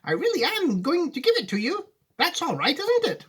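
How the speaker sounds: high, fond Wonka voice; an old showman sharing a grand surprise; kindly Wonka. Acted. Wonka telling Charlie he gets the factory, high and fond.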